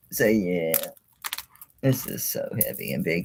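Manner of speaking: ordinary delivery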